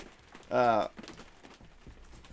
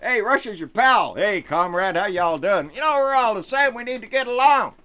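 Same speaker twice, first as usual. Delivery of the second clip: higher and louder, sarcastic chummy greeting; lightly hammed-up pal voice, mocking; mock buddy-buddy Russia fan; 7 semitones higher, 6 dB louder than usual. Vlogger mocking Republicans cozying up to Russia, sarcastic chummy voice.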